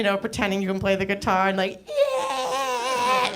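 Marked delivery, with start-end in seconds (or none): 1.9-3.4 fake enthusiasm. Faking fun at karaoke.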